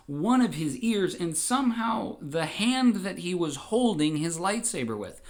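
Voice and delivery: plain reading voice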